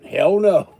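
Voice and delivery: loud, blunt mock refusal; body's loud refusal. His body refuses to go film, loud mock refusal. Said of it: "My body said".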